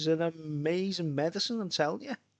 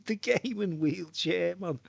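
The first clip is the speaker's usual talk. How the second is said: talking through laughter, pitched up; laughing; 4 semitones higher than usual. Real, not acted. Pitching a gaming wheelchair to viewers, laughing. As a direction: crack up while pitching the idea.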